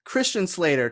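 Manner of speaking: usual speaking voice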